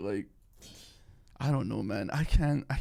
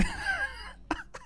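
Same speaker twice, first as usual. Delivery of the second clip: high-pitched laugh; 4 dB quieter than usual. Real, not acted. Laughing at own impression.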